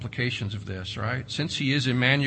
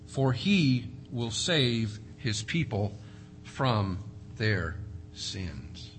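soft slow reading; 5 semitones lower, 5 dB quieter, much slower than usual. Acted. Scripture read slowly, softly.

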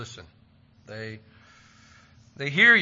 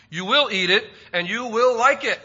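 louder and higher, a mock stern mother; mock stern mom; 6 semitones higher, 8 dB louder than usual. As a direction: lay down the law at dinner. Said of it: "every kid has heard mom say".

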